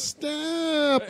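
high, drawn-out cry without the volume; mock yell. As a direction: stretch out a held-back imitation of yelling. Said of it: "Billy yells".

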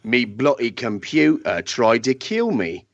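hammed-up Cockney, savouring the slang; Cockney accent. [name] performs the second parody episode in a light mock Cockney. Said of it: "with your best accent".

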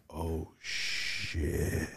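soft, low, stunned undertone; hushed dismay. Retold or imitated. Cousins looking around after the outburst, hushed dread. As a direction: quietly imitate alarmed onlookers.

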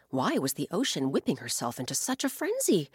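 plain reading voice

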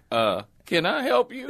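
high, slow imitated lady; imitation of a puzzled small-town lady; steakhouse lady. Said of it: "the lady looks at me and says".

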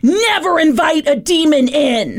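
high, loud urgent warning; stern warning. Retold or imitated. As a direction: warn them sharply. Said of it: "they were like".

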